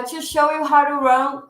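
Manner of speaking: ordinary talk, nothing marked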